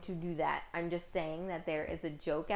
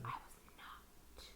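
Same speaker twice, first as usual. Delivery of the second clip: whispered, slow denial; whispering; 14 dB quieter, slower than usual. Real, not acted. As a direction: whisper it in defence. Denying being loud on phone.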